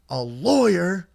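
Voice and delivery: high, loud ranting cop, mocked; hammed-up furious lecture from a cop; angry police chief. Drunk teen mocks the watch commander's high, loud anti-lawyer rant. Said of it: "starts pacing back and forth and goes".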